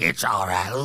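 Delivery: put-on low old-man mumble. Storyteller mimics the old men answering each other, low mumble. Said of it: "they're like".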